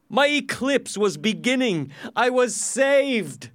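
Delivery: high, loud, triumphant narration; elated relief. A narrator's reading. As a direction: let excitement burst out, slowing at the end. Narrator realises he is saved.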